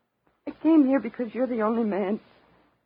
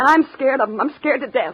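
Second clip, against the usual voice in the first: higher, louder frightened plea; 5 semitones higher, 9 dB louder than usual. Acted. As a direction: blurt out that you're terrified of him. Selma frightened of Rick.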